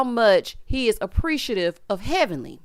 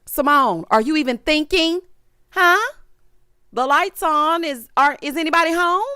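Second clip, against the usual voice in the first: mock incredulous; 6 semitones higher, 3 dB louder than usual. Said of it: "She was looking like".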